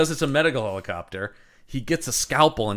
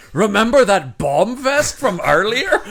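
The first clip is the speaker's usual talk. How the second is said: laughing villain; 8 semitones higher, 6 dB louder than usual. Retold or imitated. Villain quote laughing, louder.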